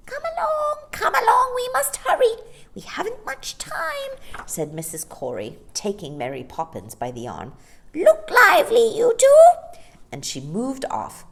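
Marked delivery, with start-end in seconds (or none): 0.1-4.1 put-on very high, urgent old lady voice with a loud "come along"; eager old Mrs Corey. A narrator's reading. Mrs Corey urging the children on. 7.9-9.8 very high and loud, barking orders; bossy old Mrs Corey. A narrator's reading. Mrs Corey ordering her daughters.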